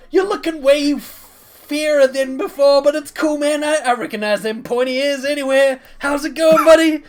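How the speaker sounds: high, loud, rambling enthusiasm; boisterous, friendly Rock figure. Acted. Rock presenting his good friends to the crowd, boisterous holler. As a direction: introduce everyone at full, cheerful volume. Game master plays Rock.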